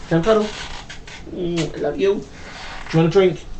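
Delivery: soft cuddling bedtime voice; real bedtime tenderness; tender. Real, not acted. Putting child to bed.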